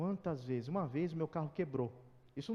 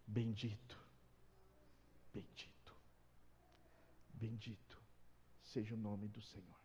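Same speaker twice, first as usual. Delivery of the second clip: much softer, lower, hushed praise; reverent quiet recital; 7 semitones lower, 6 dB quieter than usual. Acted. Pastor voicing Job's surrender to God, hushed reverence. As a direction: let the blessing fade almost to a whisper. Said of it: "E ele disse o quê?".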